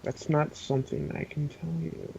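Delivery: a soft sinister withholding; evil voice. Acted. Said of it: "his evil voice".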